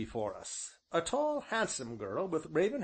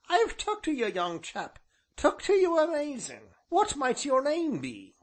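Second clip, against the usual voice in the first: high, loud rustic ancient; high-pitched, enthusiastic village elder; friendly old man; 13 semitones higher, 6 dB louder than usual. A narrator's reading. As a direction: take to him eagerly in a high old voice. Voices the ancient.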